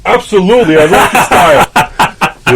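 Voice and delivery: high, loud, laughing; eager reply. Retold or imitated. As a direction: gush with eager enthusiasm. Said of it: "I was like".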